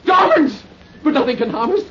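rising frantic raving, laugh and cry; unhinged shouting, breaking into laughter; wild unhinged ranting. Acted. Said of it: "quit acting like a lunatic".

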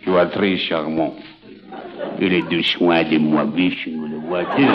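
very low, slow French lover; Charles Boyer. Shown as a demonstration. Host showing how to woo Cosette, low French-lover voice. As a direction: croon it low and unhurried in a French accent. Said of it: "give it the Charles Boyer touch".

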